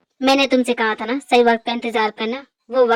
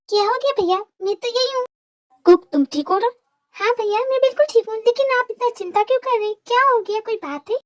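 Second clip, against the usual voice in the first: higher, puzzled female voice; young woman's voice; 11 semitones higher than usual. Acted. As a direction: play the sister asking what happened. Male actor voices Cookie.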